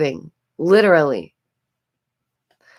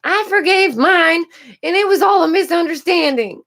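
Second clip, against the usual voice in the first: mocking imitation; 11 semitones higher, 3 dB louder than usual. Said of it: "at least one person will be like".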